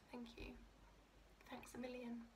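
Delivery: whispered, almost no voice; whispered grateful thanks to a friend; hushed thanks. Real, not acted. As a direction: breathe your thanks softly. Tearful quiet thanks.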